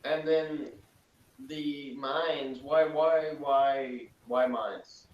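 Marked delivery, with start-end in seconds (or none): none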